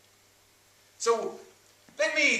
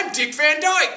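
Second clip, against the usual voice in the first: bad mock-Cockney, high and loud; 9 semitones higher, 8 dB louder than usual. Performer explains his voice to unimpressed colleagues, bad Cockney impression. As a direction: proclaim it in a hammed-up Cockney accent. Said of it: "you're doing a bad impression".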